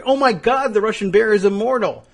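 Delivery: high, loud mock gasp of awe; mock amazement. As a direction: ham up the astonished believer. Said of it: "They would just say".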